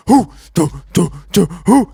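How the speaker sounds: loud rhythmic troop hoots; punchy chanted marching sounds; imitated marching grunts. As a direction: mimic soldiers grunting in step. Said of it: "And the fucking National Guards are".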